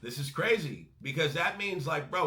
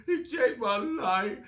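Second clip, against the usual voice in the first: higher, slow, drawn-out worshipful gush; slow mock reverence; 6 semitones higher, 4 dB louder, slower than usual. Fan repeating [name]'s bar, slow mock reverence. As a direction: savour every word like scripture. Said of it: "We look at [name] when he does those bars".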